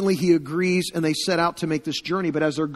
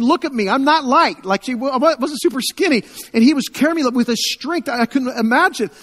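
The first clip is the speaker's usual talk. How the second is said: amazed retelling; 8 semitones higher, 7 dB louder than usual. Retold or imitated. Refugee retelling being carried.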